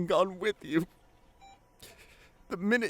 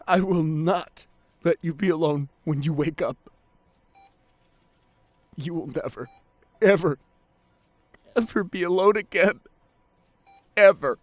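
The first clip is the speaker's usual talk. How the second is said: louder, determined vow; fierce promise; 2 semitones lower, 8 dB louder than usual. Acted. Partner vowing loudly never to leave their love alone. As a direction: swear it with force.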